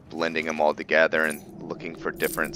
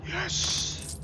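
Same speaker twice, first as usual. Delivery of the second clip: gasp, then a soft breathy yes; whispered, savouring yes; breathy relish; 8 dB quieter than usual. Real, not acted. Gamer after winning a fun fight, breathy relish.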